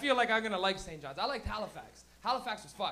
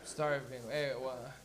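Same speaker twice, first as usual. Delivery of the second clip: much lower, softer dazed stoner mumble; stoned crowd; 9 semitones lower, 7 dB quieter, slower than usual. Retold or imitated. Comic mimicking the stoned audience, low dazed mock mumble. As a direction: drone it like a hungry stoner. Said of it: "Everyone's just looking at me going".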